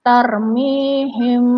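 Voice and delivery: Tarmihim with the "mi" drawn out long, slowly; long vowel demo. Shown as a demonstration. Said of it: "Jadi bacaannya harus panjang".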